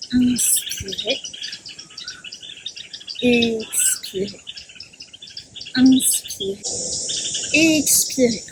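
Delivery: soft, slow, drawn-out breathing cues; gentle breath cues. Shown as a demonstration. Yoga teacher guiding the class's breathing, soft and slow. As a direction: let each word melt out gently with the breath. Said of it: "Placez de la douceur".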